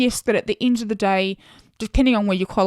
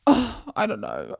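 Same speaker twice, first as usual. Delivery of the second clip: ordinary delivery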